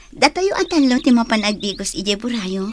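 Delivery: usual speaking voice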